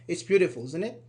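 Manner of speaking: usual speaking voice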